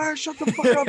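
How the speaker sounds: high, put-on yell from the window; Ted impression. Quoting a movie scene, high comic yell. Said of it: "he's like".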